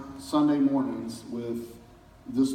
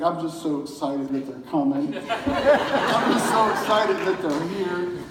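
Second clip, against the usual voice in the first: laughing excitement; 6 semitones higher, 4 dB louder than usual. Retold or imitated. Retelling Brian laughing through tears.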